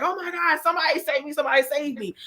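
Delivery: high, panicked cry for help; a drowning man calling for rescue in a mock panic. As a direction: play the drowning man begging to be saved. Drowning man in parable cries.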